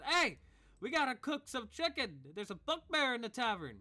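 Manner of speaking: high, loud hollered call to the kitchen; cook calling out. Acted. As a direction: yell up the stairs to the cooks, squeaky and excited. GM voices cook calling upstairs.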